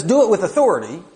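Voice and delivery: loud, fast, high commanding quote. Retold or imitated. Preacher quoting Paul's charge, a loud commanding read. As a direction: bark the command like an order from above. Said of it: "He says".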